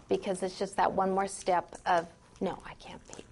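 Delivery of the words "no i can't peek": whispered to oneself; a private whispered no. Retold or imitated. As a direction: hush it like resisting a peek. Acting student resisting peeking.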